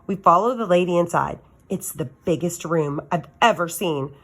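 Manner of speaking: ordinary delivery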